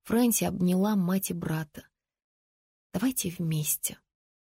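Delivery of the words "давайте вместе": soft whisper. A narrator's reading. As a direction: whisper it close to family. Girl whispers to her family.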